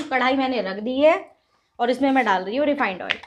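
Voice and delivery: normal talking voice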